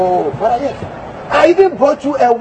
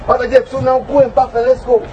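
ordinary talk

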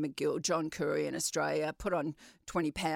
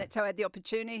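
usual speaking voice

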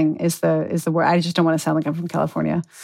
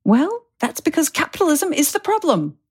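much higher, imitated English accent; 11 semitones higher than usual. Recalling a podcast guest, in an imitated English accent. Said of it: "who had a really terrific English accent".

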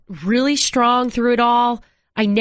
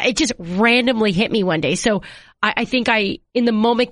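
usual speaking voice

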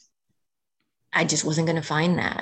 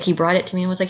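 ordinary talk

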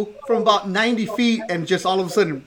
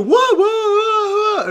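high, slow, drawn-out whoa in a put-on voice; mock laughing whoa; 3 dB louder, much slower than usual. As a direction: ham up a carefree laugh. Dwight laughing off Kobe's look.

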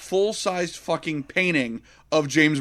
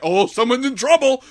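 mock excited; 8 semitones higher, 6 dB louder than usual. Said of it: "you're like".

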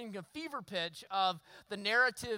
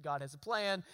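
comforting; 7 semitones lower, 5 dB quieter than usual. Retold or imitated. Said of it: "and say".

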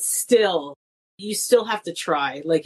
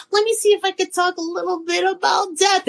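sly testing the water; 11 semitones higher than usual. Retold or imitated. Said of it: "If I see they laugh a little".

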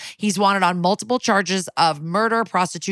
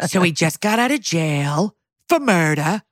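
comic character impression with exaggerated, stretched words; Big Ange. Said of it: "do the, your impression of".

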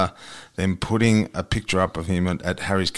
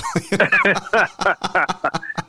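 hearty laugh; 10 semitones higher than usual. Real, not acted. Laughing at joke.

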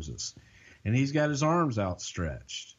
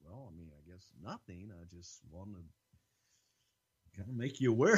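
very soft, timid, almost inaudible; meek mumble; 3 semitones lower, 10 dB quieter, faster than usual. Retold or imitated. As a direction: shrink away as you admit it.

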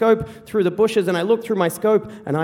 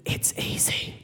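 low-volume whisper in an ear; hushed, voiceless murmur; 10 dB quieter, slower than usual. Retold or imitated. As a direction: whisper it as quiet coaxing. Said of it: "Dad just whispers in my ear".

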